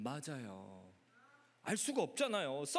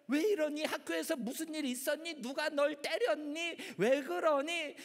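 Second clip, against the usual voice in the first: higher, pressing worried questions; fretting grandmother; 6 semitones higher than usual. Acted. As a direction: question the child anxiously through the door. Voices worried grandmother knocking.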